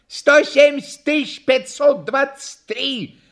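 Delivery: high, projected call; workman's shout. A narrator's reading. Workman answers the clown's request, high-pitched shout. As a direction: cry it out from the back. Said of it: "крикнул мастеровой".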